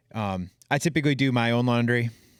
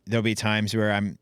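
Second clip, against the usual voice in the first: usual speaking voice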